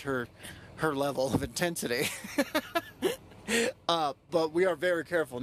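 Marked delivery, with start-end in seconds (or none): 2.1-3.1 high, rippling chuckle; amused chuckle. Real, not acted. Man admitting he matches her intensity, amused chuckle. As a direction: laugh at your own admission.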